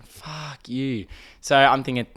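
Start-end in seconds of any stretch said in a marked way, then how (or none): 0.0-1.1 quiet, under the breath; soft aside curse; muttered inner thought. Retold or imitated. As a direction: say it as a private thought. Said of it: "In my head, I'm thinking".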